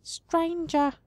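high, wistful call; the tree face wistfully addressing the traveler. Acted. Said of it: "declares wistfully".

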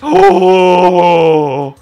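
very loud, drawn-out gasp of delight; delighted long "oh". Real, not acted. Player spots slides in the game, delighted loud gasp. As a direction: let out a big, loud "oh".

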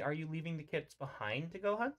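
ordinary talk

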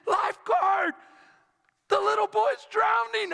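high, urgent shout for a lifeguard; retold yell. Bystanders to lifeguard as boy drowns, urgent yell. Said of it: "they yelled at me".

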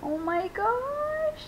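very high, drawn-out gasp of delight; awed squeal. Real, not acted. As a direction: squeal slowly in sheer wonder. Marvels at the new doll.

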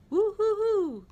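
high, loud bouncy hooting; cartoon whoop of delight; gleeful whoop. A narrator's reading. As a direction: let out a soaring hoo-hoo. Tigger's whoop in story.

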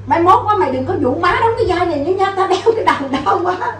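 high-pitched mock complaint; retold scolding. Actress retelling mother's refusal of the role, high mock scolding. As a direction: grumble like a fed-up older actress. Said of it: "chửi tan nát hết".

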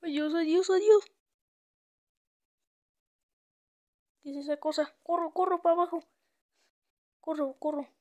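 loud, high fleeing shouts; panicked shouting. Real, not acted. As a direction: shout while running away. Fleeing the creature again. (gritos de huida)

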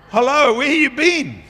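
high, loud mock indignation; a hammed-up "hello?" of exasperation; sarcastic retort. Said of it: "And you go like".

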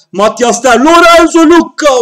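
rising shout at a near goal; shouting at the screen, near goal; excited shout. Real, not acted. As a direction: yell the names as the chance builds.

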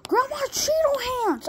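high, slow drawn-out plea; insistent squeal. Pretend. Telling a partner to grab hands, high slow squeal. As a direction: squeal it slowly, stretching each word. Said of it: "I said".

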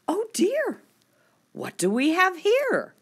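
very high, startled prim lady; surprised high. Acted. Prim poodle mother spotting the bulldogs, surprised and very high. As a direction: gasp it out primly.

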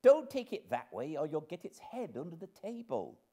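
high-pitched scolding nursemaid voice; harried nursemaid. Acted. Nursemaid warning Mum about the baby's head, harried. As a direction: warn her urgently, pitched high.

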